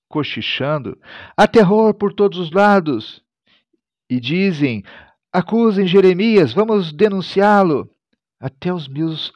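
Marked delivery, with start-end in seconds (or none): 1.4-3.2 high, raised voice of a crowd; dramatized high cry of accusers; crowd's alarm cry. A narrator's reading. Crowd's words voiced in scripture reading. 5.3-7.9 high, raised rallying call; dramatized accusers' cry; crowd's denouncing call. A narrator's reading. Crowd urging denunciation of Jeremias.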